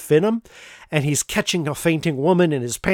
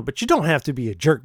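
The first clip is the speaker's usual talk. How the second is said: ordinary talk, nothing marked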